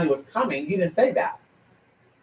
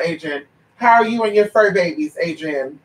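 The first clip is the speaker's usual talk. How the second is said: ordinary talk, nothing marked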